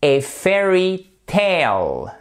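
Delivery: slow, high "fairy tale", open E in "fairy"; careful model pronunciation. Shown as a demonstration. Teacher showing the vowels, "fairy tale" slowly with E then AY. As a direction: stretch "fairy tale", E sound then long AY. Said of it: "le A I se prononce comme un E".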